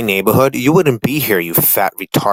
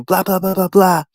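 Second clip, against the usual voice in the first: mock nagging; 8 semitones higher, 4 dB louder than usual. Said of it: "and they start telling you".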